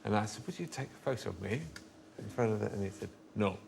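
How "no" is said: ordinary delivery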